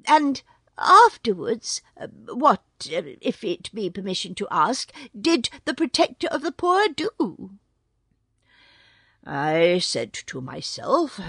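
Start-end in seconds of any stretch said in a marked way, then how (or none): 0.1-7.6 high, loud, fawning jackal yip; a sly jackal gushing in a high yelp; sly yippy jackal. Acted. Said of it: "said the Jackal".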